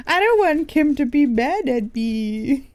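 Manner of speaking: slow, high, drawn-out whine; whiny. Acted. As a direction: plead like a worried kid. Worried about partner's disapproval.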